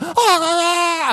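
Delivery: high, drawn-out panicked yells of ah; frantic father yell. Retold or imitated. Father yelling at a dog cornering his daughter, frantic high cries. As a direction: cry out to scare a dog off your child. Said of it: "I was like".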